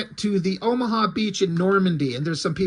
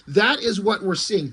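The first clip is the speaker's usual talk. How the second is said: ordinary delivery, nothing marked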